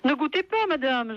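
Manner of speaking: ordinary delivery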